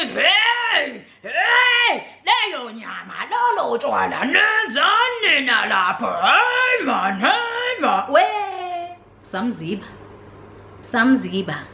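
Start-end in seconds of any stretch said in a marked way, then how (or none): 0.0-9.0 high laughing cries of disbelief; laughing exclamation. Real, not acted. Laughing in disbelief about the meat and beer. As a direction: laugh and cry out.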